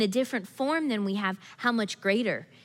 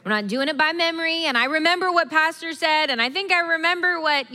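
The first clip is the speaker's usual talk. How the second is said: louder, higher, faster; rising fervor; 5 semitones higher, 4 dB louder than usual. Real, not acted. Pastor urging the church not to live by memory, loud and fast. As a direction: press the point with urgency.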